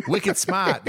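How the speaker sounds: high-pitched mock Boston accent. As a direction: playfully put on Boston speech, dropping the R. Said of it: "fun to do the Boston accent".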